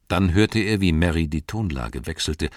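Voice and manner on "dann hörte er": ordinary delivery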